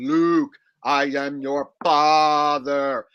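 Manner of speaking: slow, warbling line into a fan; yelling into a fan. Retold or imitated. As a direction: drag it out as if through fan blades. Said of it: "yells into the fan".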